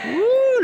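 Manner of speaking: high delighted whoop at the heat; a sudden squeal of surprise as the heating returns; surprised whoop. Real, not acted. Pastor reacts aloud to the heating returning, surprised high whoop. Heating comes back mid-sermon.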